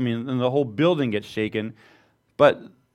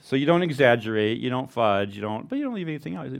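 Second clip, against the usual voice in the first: ordinary delivery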